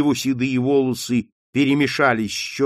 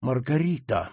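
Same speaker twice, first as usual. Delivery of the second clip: quiet trembling anger; 3 dB quieter than usual. Acted. Mother warning her daughter, quiet and trembling with anger. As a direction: say her name softly but shaking with rage. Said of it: "тихим, но дрожащим от гнева голосом".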